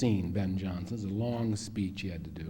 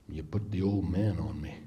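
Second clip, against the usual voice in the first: put-on grumbling old cowboy; 5 semitones lower than usual. Voices Ben's reproach by phone.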